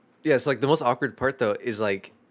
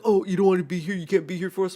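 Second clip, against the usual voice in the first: mock offended friend; 8 semitones higher, 5 dB louder, faster than usual. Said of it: "and like".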